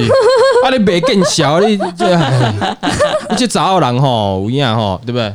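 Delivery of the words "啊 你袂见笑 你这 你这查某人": laughing tease. Real, not acted. Teasing laugh at woman.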